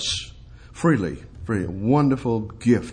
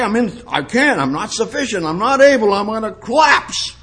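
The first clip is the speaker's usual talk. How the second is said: high-pitched, hammed-up protest getting louder; mock despair; 10 semitones higher, 6 dB louder than usual. Preacher mimicking a believer who says they can't, mock desperate. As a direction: imitate someone loudly declaring defeat.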